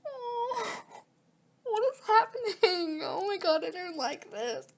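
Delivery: high, tearful cry of dismay; real distress, voice breaking high; tearful and shaken. Real, not acted. Distressed reaction while playing.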